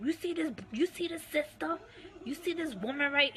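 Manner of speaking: high, scornful imitation of the husband; degrading mock tirade. Husband mocking his wife in front of Ayasha Allah, high sneering imitation. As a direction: pitch it up and sneer at her. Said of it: "degrading me in front of Ayasha".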